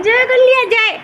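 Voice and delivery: very high, loud playful cheer. Real, not acted. Cheering baby after prayer.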